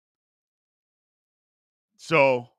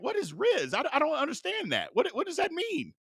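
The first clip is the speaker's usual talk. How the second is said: higher, softer mock bewilderment; puzzled; 8 semitones higher, 7 dB quieter than usual. Host recalling asking a guy's son about slang, hammed-up puzzled. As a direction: retell your confusion in a high, quiet voice. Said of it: "I was puzzled with the Riz".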